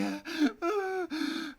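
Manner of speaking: high loud cackle; witch cackle. A narrator's reading. Witch laughing as Gretel weeps, high cackle. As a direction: cackle over the weeping girl.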